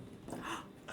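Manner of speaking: sharp excited intake of breath; delighted gasp. Real, not acted. Gasp at the spell idea.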